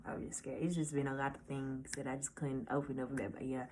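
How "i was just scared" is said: barely whispered confession. Real, not acted. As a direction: drop to a breathless whisper. Confessing fear, voice drops.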